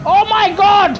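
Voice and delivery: high, loud cry of dismay; real exasperated yell, pitched high; exasperated outburst. Real, not acted. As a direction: blurt it out in real frustration at a race mishap. Driver reacts to mishap in race.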